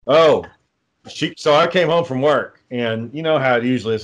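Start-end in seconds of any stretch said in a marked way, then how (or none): none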